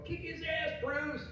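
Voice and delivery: high cheer at the screen; mock rowdy fan yelling at Bruce Lee; cheering on a kung fu hero from the seats; crowd cheering. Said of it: "They were going".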